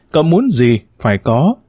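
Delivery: ordinary delivery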